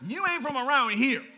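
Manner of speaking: loud, high call-out; shouted accusation. Acted. Accuser exposing Peter by his accent, shouted accusation.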